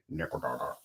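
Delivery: low, quiet mumbled name; helmeted character voice. Retold or imitated. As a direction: imitate a character's low, soft talk. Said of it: "the way that this character sounds when he talks".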